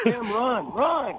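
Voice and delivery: high laughing shout of run. Pretend. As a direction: yell at him to run. Urging caller to flee cops.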